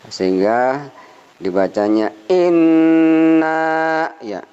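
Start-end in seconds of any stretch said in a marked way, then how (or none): 2.3-4.1 "inna" stretched out long and slow; held inna. Shown as a demonstration. Teacher shows the long reading of "inna". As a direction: draw out "inna" slowly. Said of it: "dibacanya agak panjang".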